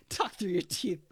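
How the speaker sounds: words through real laughter; giggling while talking; laughing. Real, not acted. Laughing advice.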